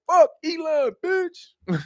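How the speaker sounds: high mock curse, dropping off at the end; squeaky mock Trump. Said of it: "Trump was like".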